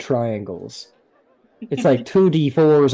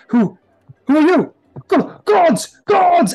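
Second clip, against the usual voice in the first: high, loud panicked cry; frightened nobleman yelling for the gods; startled patriarch; 7 dB louder, slower than usual. Acted. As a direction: shriek as if caught off guard. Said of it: "he turns around and goes".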